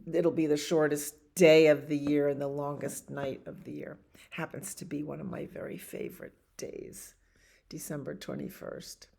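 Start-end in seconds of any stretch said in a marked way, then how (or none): 4.3-9.1 soft whispered confidence; host sharing a favourite day in a whisper. Real, not acted. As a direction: whisper it like a little secret.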